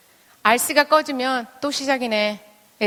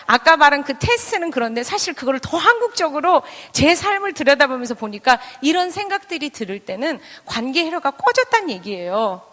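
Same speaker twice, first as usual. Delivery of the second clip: normal talking voice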